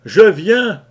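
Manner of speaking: high, loud cry; shouting. Acted. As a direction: shout it up to the deck. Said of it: "criai-je à Latimer".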